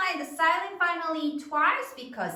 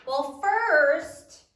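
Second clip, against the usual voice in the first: ordinary talk, nothing marked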